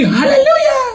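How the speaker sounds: mock high-pitched church-lady hallelujah; high falsetto hallelujah. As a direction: squeal it like the ladies. Mimicking women's high hallelujah.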